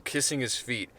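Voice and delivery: ordinary delivery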